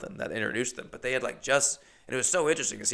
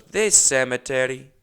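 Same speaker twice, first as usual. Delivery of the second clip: loud imitated Russian accent; thick Russian accent; 5 dB louder than usual. Old Russian lady names her destination, thick imitated Russian accent. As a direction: announce it in a heavy Russian accent. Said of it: "Very thick accent".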